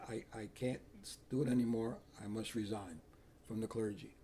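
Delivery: subdued confession of being finished; retold defeat. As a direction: give up softly, drained. Acts out his exhausted resignation.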